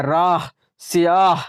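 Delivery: राह, सियाह said slowly, with the final ह clearly breathed out at the end; sounding the final h. Shown as a demonstration. Teacher showing students the pronounced h, slowly. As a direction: stretch राह and सियाह and let the final ह be heard. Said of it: "हा हम लोग निकाल रहे हैं".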